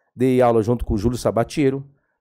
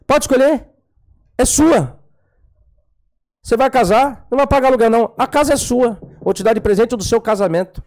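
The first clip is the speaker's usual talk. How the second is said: higher and louder, generous and insistent; eager pastor; 9 semitones higher, 3 dB louder than usual. Retold or imitated. Pastor pressing a house on the young man, higher and louder. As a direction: push the gift on him, pitched high and loud.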